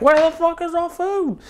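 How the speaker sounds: high mock-impatient guest demanding food. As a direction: squeal it like a starving guest. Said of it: "and they're like".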